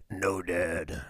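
imitating the son's low voice, refusing his dad. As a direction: say it deep and deadpan. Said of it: "his voice was so low".

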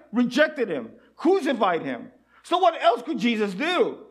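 ordinary talk

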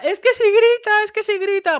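high, loud mock of what people say; mocking shrill quote. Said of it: "la gente dirá". (imitando burlón, mucho más agudo)

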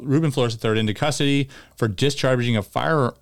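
normal talking voice

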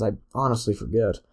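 ordinary delivery

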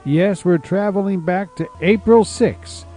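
ordinary delivery

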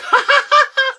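very loud, high mock cackle; imitated laughing fit. Drugged brother laughing on the phone to sister, mock cackle. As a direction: squeal out a loopy, exaggerated laugh. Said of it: "laughing and everything".

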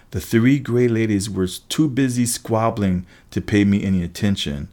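ordinary delivery, nothing marked

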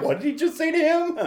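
high squeal laughing through the words; laughing disbelief. Retold or imitated. Church gasp, retold shocked reaction.

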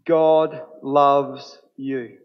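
"God loves you" drawn out very slowly, "God" stressed; deliberate, weighty proclamation; slow emphasis. Shown as a demonstration. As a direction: let each word land, unhurried. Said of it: "Would you say it with me together".

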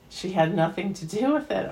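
ordinary talk, nothing marked

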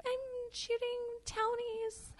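high, slow, small sheepish reply; mock meek teenager; timid kid voice. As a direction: shrink as you answer. Her timid younger self.

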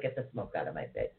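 low, soft, fast grumble; mock grumble. Host voices friend being saged, low grumbling protest. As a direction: growl it under her breath as the annoyed friend. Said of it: "she's like".

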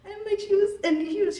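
ordinary talk, nothing marked

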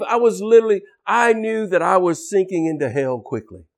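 normal talking voice

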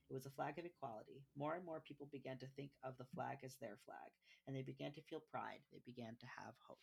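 soft, even storybook reading; reading aloud. Acted. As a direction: read it gently to a child. Reads the picture book aloud.

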